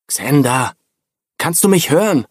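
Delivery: urgent, insistent question. A narrator's reading. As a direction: press him hard to answer. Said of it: "fragte Julian eindringlich".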